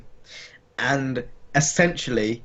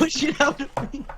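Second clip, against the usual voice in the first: high, wailing hammed-up birth cries; mock labor moaning; 10 semitones higher, 5 dB quieter than usual. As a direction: overplay a woman in labor, whimpering. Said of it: "This is what I'm like when I'm giving birth".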